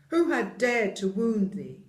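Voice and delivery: loud, furious giant demand; angry giant. Acted. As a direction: roar it in outrage. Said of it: "his face grew red with anger".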